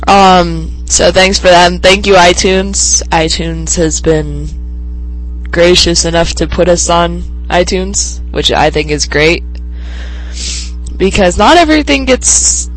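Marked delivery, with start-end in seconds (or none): none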